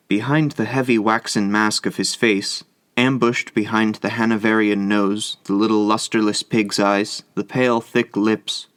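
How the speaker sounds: plain reading voice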